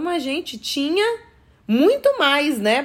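arguing the pay was there all along; incredulous protest. Retold or imitated. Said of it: "Eu falei".

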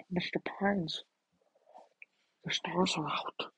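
whispered stargazing line; hushed whisper. Retold or imitated. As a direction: breathe it to Mister Pines. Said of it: "I can't do Mister Pines".

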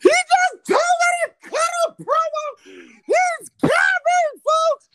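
high, slow hype shout; wrestling promo. Acted. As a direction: announce yourself like a wrestler. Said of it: "could you cut us a promo".

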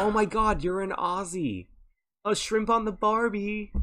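high, slow, gushing American imitation; mock an American thrilled to meet an Aussie; excited American. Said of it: "they're like".

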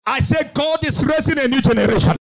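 ordinary talk

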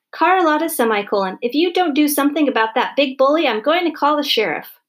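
high-pitched, indignant phone tirade; furious mother. A narrator's reading. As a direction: threaten her like an outraged mother. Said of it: "she was so mad she was choking".

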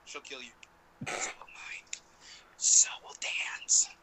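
hushed whisper; slow conspiratorial whisper. Real, not acted. Whispered joke about wives.

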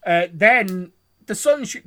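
usual speaking voice

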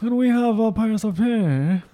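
high, slow, exaggerated posh English; posh English accent. Child asking Irish father for pie, mock posh English accent. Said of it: "posh accents".

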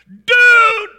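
high, very loud cry of joy; joyful yell. Acted. Husband shouting to his long-lost horse, joyful yell. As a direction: shout his name across the field. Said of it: "he yelled".

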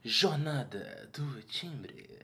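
slow, drawn-out PA announcer voice; airport announcement. Pretend. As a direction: stretch it like a sultry terminal announcement. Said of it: "eu tentei fazer a voz do aeroporto". (voz sensual de aeroporto, bem lenta)

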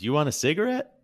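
cheeky, high-pitched offer; playful. Retold or imitated. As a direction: playfully toss out the question, high and loud. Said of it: "he gets a little playful with him".

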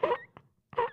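whispered, then a squeak at the end; whispered mock threat. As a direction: hiss a playful death threat. Joking threat to cohost.

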